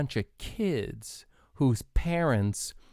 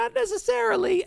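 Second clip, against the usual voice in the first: imitating cohost. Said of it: "And then you go".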